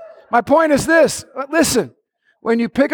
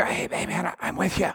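faint breathy whisper in the ear; 12 dB quieter than usual. Pretend. A timid God whispering to a believer, soft whisper. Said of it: "whispering in your".

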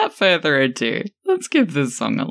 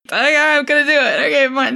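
loud excited; 10 semitones higher, 3 dB louder, faster than usual. Real, not acted. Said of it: "Don't yell at me".

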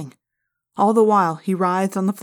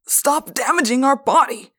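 anguished cry; 6 semitones higher, 5 dB louder than usual. A narrator's reading. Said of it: "Mister Pardue cried".

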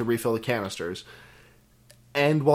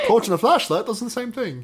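playful fake British accent, a bit higher; mock British accent; 9 semitones higher than usual. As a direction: tease the British listeners in a put-on British accent. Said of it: "That's my British accent".

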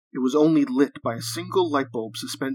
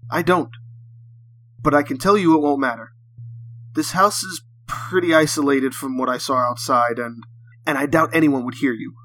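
louder, firm captor's reply; 7 dB louder than usual. A narrator's reading. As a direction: project it firmly at your prisoner. Captor menacing a bound man.

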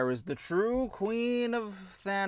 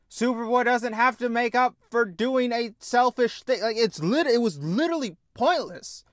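loud, higher frustrated rant; heated rant; 8 semitones higher, 5 dB louder than usual. Real, not acted. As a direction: vent it hard. Heated rant about the episode.

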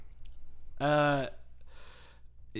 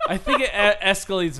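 louder words broken by laughter; laughing; 5 semitones higher, 7 dB louder than usual. Real, not acted. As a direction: giggle through it. Laughing at the joke.

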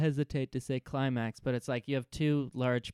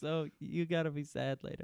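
mock earnest advice; 4 semitones higher, 4 dB quieter than usual. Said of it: "mock Saeed advice".

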